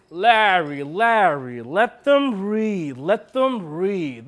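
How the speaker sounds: slow, drawn-out cutting in; insistent interruption. Acted. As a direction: stretch each word to rein the director in. Colleague cuts in on the director.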